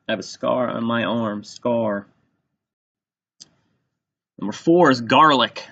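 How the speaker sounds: ordinary talk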